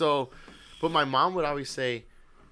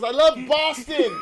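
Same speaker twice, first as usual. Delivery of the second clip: high, loud, slow, through laughter; laughing quote; 9 semitones higher, 9 dB louder, slower than usual. Retold or imitated. Grandpa about his music, loud laughing. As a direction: gush it while laughing. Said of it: "He goes".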